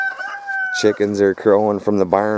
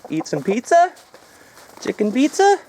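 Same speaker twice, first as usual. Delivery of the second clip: higher, coaxing pet-talk voice; talking to chickens. Real, not acted. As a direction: coo at the birds like calling pets.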